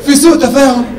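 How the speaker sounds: rising disbelief on the phone; exasperated complaint. Retold or imitated. Complaining call to Sameh.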